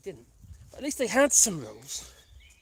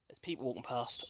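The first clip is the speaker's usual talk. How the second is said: hushed; 9 semitones lower, 14 dB quieter than usual. Real, not acted. Hushed as walkers pass.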